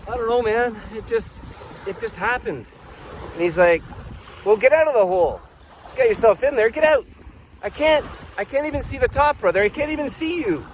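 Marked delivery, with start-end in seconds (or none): 0.1-2.7 high, softer, bewildered voice; small, baffled explanation; helpless reply. Acted. 4.4-7.1 high-pitched, exasperated order; pushy call down to someone stuck; impatient insistence. Acted. Rescuer orders him out. 7.6-10.7 high, distressed protest; desperate, high-pitched voice; helpless pleading. Acted. Trapped man pleads back.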